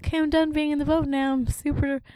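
put-on high, whiny kid voice; seasick kid. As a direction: complain about the boat ride. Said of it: "I'd be like".

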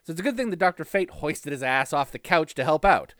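ordinary talk, nothing marked

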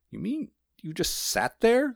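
very high, slow disbelieving question; high-voiced incredulous postman. A narrator's reading.

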